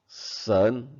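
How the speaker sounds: "Sen" with a rising second tone. Shown as a demonstration. Teacher shows children the Mandarin word, "Sen" rising in second tone. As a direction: let the pitch climb through "Sen". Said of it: "nada kedua".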